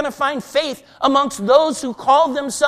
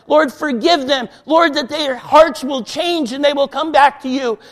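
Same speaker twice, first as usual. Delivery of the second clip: raised, urgent plea to the Lord; fervent pleading prayer; 4 semitones higher, 4 dB louder than usual. Acted. Preacher voicing believers praying on their knees for others, fervent and pleading. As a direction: cry out for mercy as if on your knees. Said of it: "we're gonna be on our knees saying".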